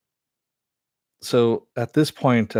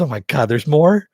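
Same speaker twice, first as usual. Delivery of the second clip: high, loud exasperated outcry; hammed-up disbelief, climbing higher; mock dismay; 7 semitones higher, 6 dB louder than usual. Villain breaking the fourth wall, mock dismay.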